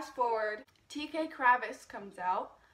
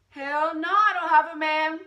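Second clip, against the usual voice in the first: loud, high, emphatic no; shouted reply; 6 semitones higher, 15 dB louder than usual. Retold or imitated. Fan shouting back at the singer on stage, loud and high. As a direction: yell it back into the mic, delighted.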